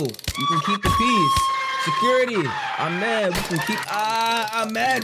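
high, wailing mock outrage; hammed-up pleading. Mock activist begs security to keep the peace, high overwrought wail. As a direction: plead with mounting, drawn-out cries. Acted outburst pleading against tasing.

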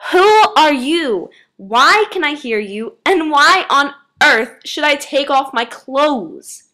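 high, loud, baffled demand; indignant questioning. A narrator's reading. Girl to unseen voice, loud indignant bafflement. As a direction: demand answers incredulously.

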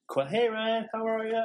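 high, sing-song fake cheer; chirpy roommate playing innocent; fake-sweet greeting. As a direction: act breezy as if nothing happened. Roommate voiced, fake friendly.

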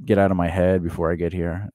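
usual speaking voice

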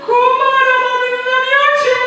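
a wife announcing a mosquito in the tent, hammed-up alarm; dramatic alarm. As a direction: announce the disaster like a siren.

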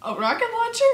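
ordinary talk, nothing marked

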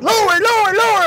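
high, repeated mock command; mock barked order. Instructor ordering trainee down the wall, high mock bark.

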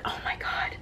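breathless whispered awe; awed whisper. Real, not acted. Awed by diary bookmark.

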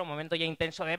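normal talking voice

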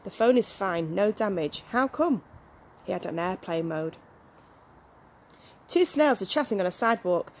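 ordinary delivery

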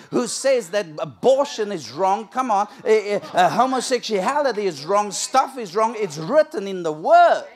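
roaring sermon climax; full-force preaching. Acted. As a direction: thunder it from the pulpit.

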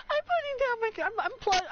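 high, stammering squeal; high-pitched flustered outburst; flustered panic. Real, not acted. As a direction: squeak it out, tripping over words. Flustered by gifted subs.

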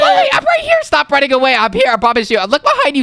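high, urgent shouting; frantic, high-pitched yelling; calling after someone. Acted. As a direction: shout after a friend running away. Shouting after fleeing friend.

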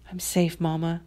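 very soft, private murmur; whispered. A narrator's reading. Lisa speaking to her distant mother, whispered. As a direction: hush it right down, as if to someone absent. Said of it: "she whispered".

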